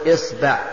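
"إصبع" said as isba', i then a; opening i, middle a. Shown as a demonstration. Teacher lists variants for students, "إصبع" as isba'. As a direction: say "إصبع" with kasra on hamza, fatha on ba. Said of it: "كسر الهمزة".

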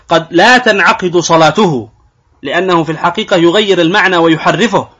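ordinary talk, nothing marked

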